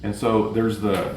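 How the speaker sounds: usual speaking voice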